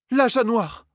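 cried out. A narrator's reading. The heiress alarmed by the words, high cry. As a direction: blurt "L'âge noir" out in alarm. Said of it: "cria la fille héritière".

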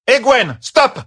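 loud high command to stop; piercingly high, urgent shout. A narrator's reading. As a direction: yell the name, then the order. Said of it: "cria Catherine".

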